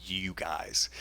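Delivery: low, very slow retold dismissal; understated, drawn-out mock fury; pissed off. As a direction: drag it out low, imitating quiet anger. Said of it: "I'd be pissed off".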